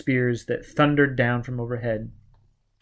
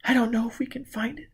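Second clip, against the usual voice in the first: put-on scared young woman; 11 semitones higher, 4 dB quieter, faster than usual. A narrator's reading. Gisbella's voice.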